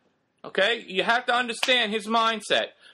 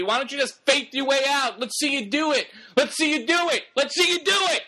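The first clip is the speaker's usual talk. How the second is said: goading taunt, climbing higher as it goes; mocking tempter, pitch rising steadily; taunting devil; 3 semitones higher than usual. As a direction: needle them with repeated challenges, building to a high-pitched dare. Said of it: "The author of that moment is the devil".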